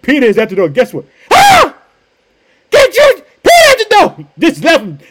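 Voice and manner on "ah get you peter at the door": very high, loud excited shriek; overjoyed scream. Acted. Servant girl announcing Peter to the church, overjoyed shriek. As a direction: squeal the news at the top of your voice. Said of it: "she was so full of excitement".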